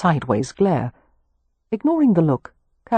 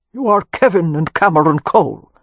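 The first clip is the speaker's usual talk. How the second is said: put-on old Anglo-Irish earl, louder and stated; a flowing Anglo-Irish lord's statement; Anglo-Irish accent; 6 dB louder than usual. A narrator's reading. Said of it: "in flowing Anglo-Irish accent".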